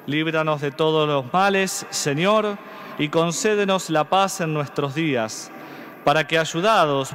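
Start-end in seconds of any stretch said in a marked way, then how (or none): none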